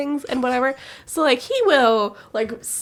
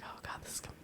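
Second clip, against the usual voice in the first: very soft night whisper; 26 dB quieter, much faster than usual. Retold or imitated. Wife whispering in bed at her sleep-talking husband. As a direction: whisper in disbelief. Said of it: "I was like".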